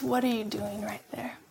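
whispered, as God's gentle voice; still small voice. Acted. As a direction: breathe it like a soft wind. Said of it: "that still, small, gentle, blowing voice".

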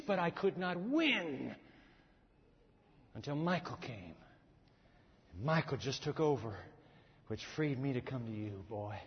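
ordinary talk

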